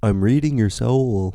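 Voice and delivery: imitating a mystic, slowly; slow intense claim. Strange woman looking him in the eyes, slow and intense. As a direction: draw it out as a solemn revelation. Odd woman staring, retold.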